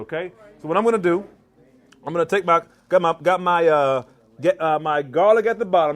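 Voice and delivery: ordinary talk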